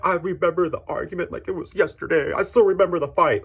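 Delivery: high, sharp imitation of the ex-wife; ex-wife bringing up the old fight, mocked high; quoting the ex. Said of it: "she's like".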